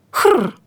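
high, short rolled growl noise; mocking whirr. A narrator's reading. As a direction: imitate a whirring stick to tease. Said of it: "захурчав насмішкувато Лаврін".

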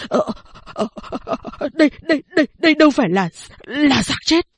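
frightened stammer rising high. Acted. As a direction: stumble over the words in fright. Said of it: "miệng lắp bắp". (lắp bắp sợ hãi, giọng cao lên)

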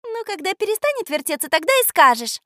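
high-voiced girlish sarcasm; snide teasing. A narrator's reading. Girl needling her forgetful friend, snide. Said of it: "съехидничала Люська".

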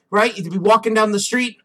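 usual speaking voice

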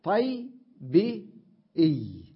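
طي، بي، إي slowly as "tay, bee, ee"; sound-by-sound breakdown. Shown as a demonstration. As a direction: spell out the y sounds in طي، بي، إي one by one. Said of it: "ياء ساكنة".

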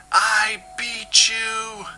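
very high, slow triumphant yell. Acted. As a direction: scream the victory even bigger. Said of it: "bellowed at the oily gyre".

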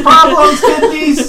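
loud laughing echo; echo shout. Acted.

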